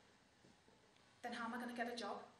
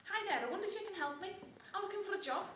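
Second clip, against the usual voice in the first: higher-pitched, eager request; pitched up as if asking at a counter; lightly acted hopeful jobseeker; polite enquiry; 8 semitones higher, 3 dB louder than usual. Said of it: "And I says".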